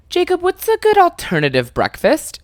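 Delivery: high-pitched mimicked question; imitating ex-girlfriend. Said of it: "my girlfriend at the time said".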